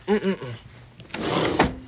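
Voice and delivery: ordinary talk, nothing marked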